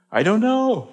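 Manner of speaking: high, loud pained I don't know; anguished cry. Acted. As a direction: cry out helplessly. Pastor's anguished wondering.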